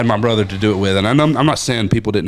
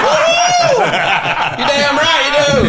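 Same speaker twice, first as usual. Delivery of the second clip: very high, louder laughing whoop; whooping dad imitation; 5 dB louder, slower than usual. Dad whoops at his son's rant, very high and laughing. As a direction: cheer it like a proud dad.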